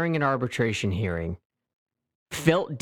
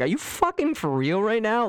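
higher, disbelieving, stress on fucking; incredulous mock reaction; 8 semitones higher than usual. Person on the other end of the phone, incredulous. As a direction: sound baffled and put out. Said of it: "is gonna be like".

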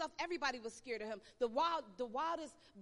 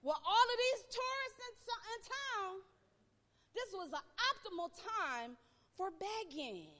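ordinary talk, nothing marked

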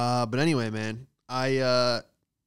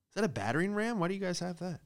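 usual speaking voice

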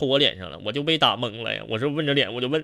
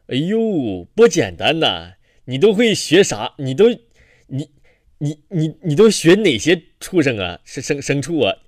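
lightly voiced old uncle, stammering and louder; a nosy old man fumbling his words; a hint of a chatty old man getting louder; 2 semitones higher, 5 dB louder than usual. Old man asks about studies.

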